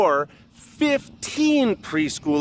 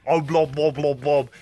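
burble it like you're greeting a big cat; lion greeting noises; 3 dB louder than usual. Shown as a demonstration. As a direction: keeper making friendly blubbery lion talk. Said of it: "And then I go".

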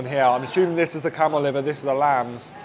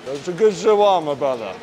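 speaking between bites; chewing while talking. Real, not acted. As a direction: mouth partly full. Seen 1: Bites wrap at -3 to -1, still chewing while speaking.

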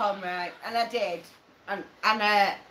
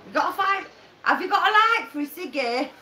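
attempted Mancunian, pitched up; Manchester accent; 5 semitones higher than usual. Shown as a demonstration. Host demonstrating for a mate, attempted Manchester accent. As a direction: have a shaky go at Manchester accent. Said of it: "I can't even do a Manchester accent".

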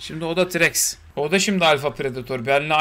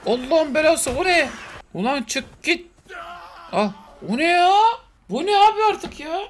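alarmed shouts; 11 semitones higher than usual. Real, not acted. To his viewers as more beasts attack: high alarmed shouts. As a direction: cry out at yet another attacker.

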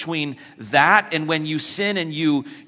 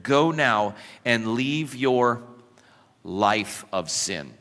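slow command; 3 semitones lower, slower than usual. Acted. Jesus' command, read slowly.

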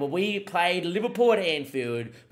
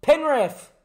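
excited penalty shout; 10 dB louder than usual. Real, not acted. Penalty incident in the match.

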